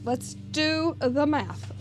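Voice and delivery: "Let's do the math" drawn out slowly, word by word; slow and deliberate. Shown as a demonstration. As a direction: spell out the sum to yourself, slowly.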